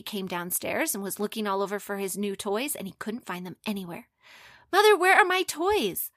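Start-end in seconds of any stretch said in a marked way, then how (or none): 4.7-6.1 loud, higher-pitched call to mother. Acted. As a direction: shout across the house. Boy calling for missing toys.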